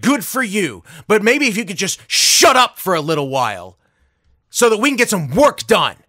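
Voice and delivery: imitating a fed-up man's loud, sarcastic scolding; acted angry rant. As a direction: build from indignant to exasperated.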